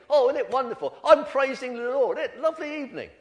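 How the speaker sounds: loud, high, over-the-top joy; gushing praise. Retold or imitated. Nephew praising the Lord on the phone, gushing. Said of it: "that was just unnatural".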